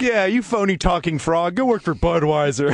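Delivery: slow, high croaking tease; mock frog voice. Friends tease his accent in a slow mock frog voice. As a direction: tease like a phony talking frog.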